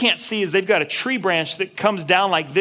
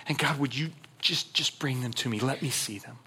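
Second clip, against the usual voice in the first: hushed prayer; 2 semitones lower, 8 dB quieter than usual. Acted. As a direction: drop to a quiet, intimate appeal to God. Pleading prayer, voice dropping low.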